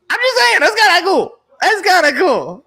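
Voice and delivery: giddy high-pitched teasing repeat; high playful squeal. Real, not acted.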